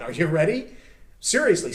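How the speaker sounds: ordinary talk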